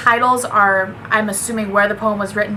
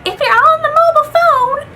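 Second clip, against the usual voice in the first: put-on very high, loud old-lady voice; mock yourself as an old woman, pitched way up and held high; exaggerated shrieky old woman impression; 7 dB louder than usual. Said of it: "I sound like an old woman".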